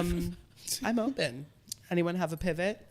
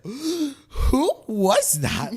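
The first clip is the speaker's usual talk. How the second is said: high, drawn-out mock horror; slow, loud, aghast mock exclamation; retold shock; 11 semitones higher, 9 dB louder, much slower than usual. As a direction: gasp it loud, scandalised at yourself. Retells shock at own words.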